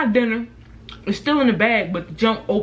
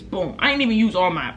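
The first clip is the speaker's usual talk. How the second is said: ordinary talk, nothing marked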